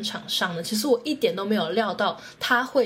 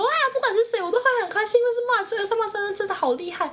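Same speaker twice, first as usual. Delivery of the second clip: much higher, squealing with excitement; thrilled fangirl squeal; 12 semitones higher, faster than usual. Retold or imitated. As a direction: gush like a fan losing it at a concert. Retold screaming along at concert. (兴奋尖叫的粉丝语气)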